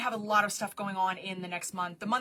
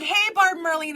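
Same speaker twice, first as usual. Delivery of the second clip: cheery greeting; 7 semitones higher, 9 dB louder than usual. Retold or imitated. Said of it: "You're like".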